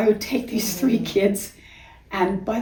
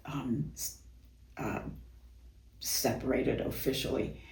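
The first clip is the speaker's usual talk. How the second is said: whisper; 7 semitones lower, 14 dB quieter than usual. Real, not acted. Hushed confession.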